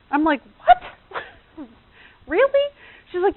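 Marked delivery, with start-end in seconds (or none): 0.6-2.7 high, incredulous what, really; mock-shocked, much higher; shocked disbelief. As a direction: react in stunned disbelief. Her shocked reply to friend.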